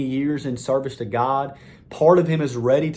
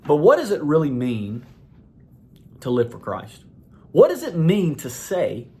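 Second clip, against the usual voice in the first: ordinary talk, nothing marked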